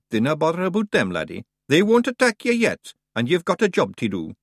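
Scottish Feegle voice. A narrator's reading. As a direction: play the cocky little Scot. Rob Anybody voiced in Scots.

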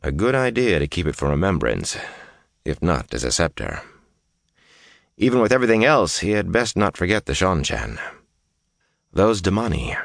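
plain reading voice

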